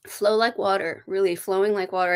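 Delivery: ordinary delivery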